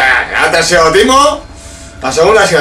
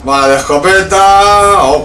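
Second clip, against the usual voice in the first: usual speaking voice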